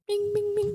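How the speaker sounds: high, soft bing bing piano sounds; piano imitation. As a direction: sing the piano notes up high. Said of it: "Kasih gue piano dong".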